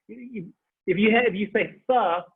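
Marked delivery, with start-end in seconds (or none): none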